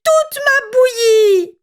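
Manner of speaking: little bear crying over his eaten porridge; Baby Bear whimpering. A narrator's reading. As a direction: whimper like a tiny bear cub. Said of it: "pleurnicha Bébé Ours de sa toute petite voix".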